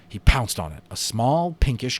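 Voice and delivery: ordinary delivery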